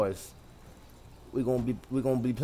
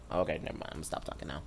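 sheepish mumble; 11 semitones lower, 13 dB quieter, faster than usual. Real, not acted. Joke falls flat.

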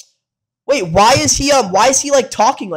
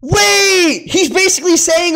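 excited; 11 semitones higher, 3 dB louder, much slower than usual. Real, not acted. Hyped reaction to the song.

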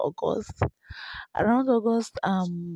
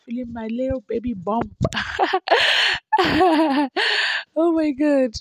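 high and louder, giggling through it; laughing; 5 semitones higher, 4 dB louder than usual. Real, not acted. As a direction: laugh at herself while talking. Laughing at her own bump clip.

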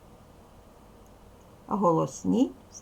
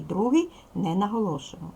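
normal talking voice

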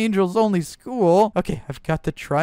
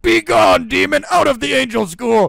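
loud, stern banishing cry; commanding shout; 5 semitones higher, 6 dB louder than usual. Acted. Principal shouting a demon out of school. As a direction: cast the demon out.

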